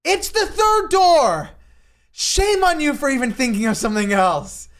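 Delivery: playfully scolding the author; mock yelling friend. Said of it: "He's like".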